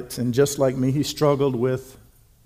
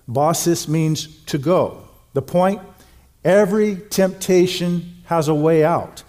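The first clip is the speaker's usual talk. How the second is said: normal talking voice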